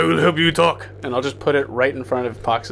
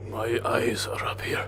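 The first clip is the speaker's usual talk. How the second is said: whisper; 4 semitones lower, 6 dB quieter than usual. Pretend. Coy aside after calling out.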